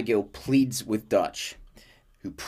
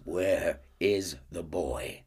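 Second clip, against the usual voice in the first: menacing; 4 semitones lower, 6 dB quieter, much slower than usual. Acted. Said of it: "And he says".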